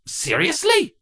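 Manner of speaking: high, disbelieving squeak; incredulous. A narrator's reading. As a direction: scoff in disbelief. Cardus reacts to the checklist.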